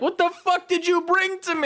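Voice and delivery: high-pitched incredulous complaint; mock outrage. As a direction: protest it high, in comic disbelief. Retold outrage at friend's ambush.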